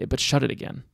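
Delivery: usual speaking voice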